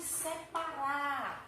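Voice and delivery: SEPARAR stretched very slowly, "se-pa-rar"; syllable by syllable. Shown as a demonstration. Teacher showing how to divide words, separar drawn out. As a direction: draw out every syllable of "separar". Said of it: "Eu tenho que separar silabicamente".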